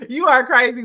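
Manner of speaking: loud laughing remark. Real, not acted. Laughing at the host's impression. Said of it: "laughing at co-host".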